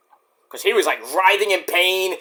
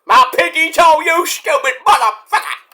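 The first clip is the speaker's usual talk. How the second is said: high, louder cry of pain then a curse; mock pained yell; 10 semitones higher, 4 dB louder than usual. Jimmy Uso yelling over his broken toe, hammed-up. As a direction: ham up a hurt wrestler's yell.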